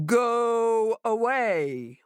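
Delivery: slow, booming warning through the door; booming monster. A narrator's reading. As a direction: boom it out like a hidden beast.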